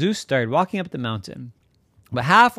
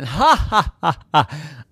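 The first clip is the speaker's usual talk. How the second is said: big, hammed-up ha-ha; acted laughter; 5 semitones higher, 4 dB louder than usual. Zeus laughing at the llama, hammed-up. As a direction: laugh out each ha, pitched up. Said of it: "And Zeus laughed again".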